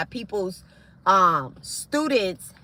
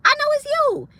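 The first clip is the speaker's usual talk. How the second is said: sudden very high, loud accusation; shrill callout; 4 dB louder than usual. Real, not acted. To the hidden troll on her page: very high accusing cry. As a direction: shriek that you know who it is.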